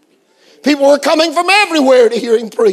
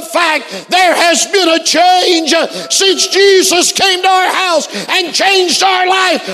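full-force preaching; 5 semitones higher than usual. Real, not acted. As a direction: drive each phrase home like a revival preacher.

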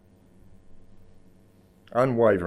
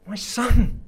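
overjoyed father; 13 semitones higher, 6 dB louder than usual. Acted. Father embraces returning son.

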